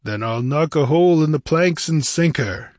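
grimly defiant hero; grim threat. A narrator's reading. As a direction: answer with a hard warning. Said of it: "answered Conan grimly".

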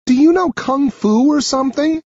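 high-pitched, astonished question; high character voice, incredulous; amazed girl. Acted. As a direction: ask in awe as the rescued girl. Sarah amazed after the fight.

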